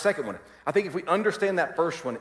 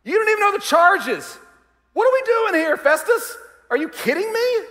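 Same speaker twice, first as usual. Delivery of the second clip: much higher, much louder indignant shout; exasperated shout; 10 dB louder than usual. Pretend. Preacher pretending he is Paul yelling at Festus, indignant shout. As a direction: blow up at Festus. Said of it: "I wanna be like".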